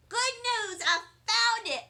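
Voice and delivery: high, nervous blurt; a touch of fake cheer hiding panic. A narrator's reading. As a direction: chirp it out, nervously bright. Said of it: "very nervous".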